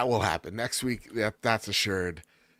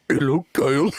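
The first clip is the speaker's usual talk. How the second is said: louder creepy clown voice; Pennywise voice; 4 semitones higher, 7 dB louder than usual. Acted. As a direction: greet him like a sinister clown. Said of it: "Pennywise? / Yeah, Pennywise".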